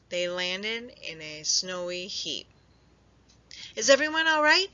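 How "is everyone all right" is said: higher, louder anxious call; worried gasp. A narrator's reading. Hedgehog after the fall, worried call. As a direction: check on friends, breathless. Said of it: "gasped Little Hedgehog".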